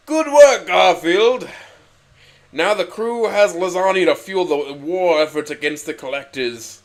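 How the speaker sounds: loud, high admiring boss; Illusive Man voice. A narrator's reading. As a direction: praise him as the boss. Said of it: "with admiration".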